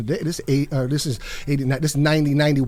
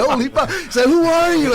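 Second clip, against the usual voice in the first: high, louder mock amazement; astonished; 11 semitones higher, 4 dB louder than usual. Shan to the stranger who feeds him, high astonished outburst. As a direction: blurt it out pitched way up, astounded.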